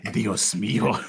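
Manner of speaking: mock Spanish. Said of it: "speak in Spanish".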